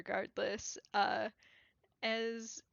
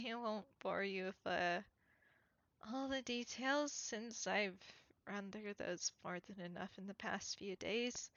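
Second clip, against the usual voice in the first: lightly voiced weary character, softer and subdued; understated tired confession, trailing softer; exhausted man, guarded; 2 semitones lower, 6 dB quieter than usual. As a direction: confide it quietly, a touch worn out. Plays the exhausted character answering.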